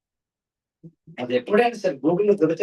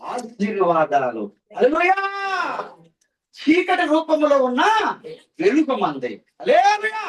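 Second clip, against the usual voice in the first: preaching at full force; 9 semitones higher than usual. Acted. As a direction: call it out to the congregation. Preaching call and response. (ఉత్సాహంగా బోధించడం)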